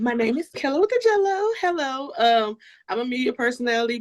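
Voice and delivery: usual speaking voice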